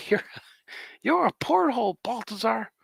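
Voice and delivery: high-pitched pretend insult; squeaky play-swearing; mock swearing. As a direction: hurl it as a silly curse. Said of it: "it sounds like you're swearing".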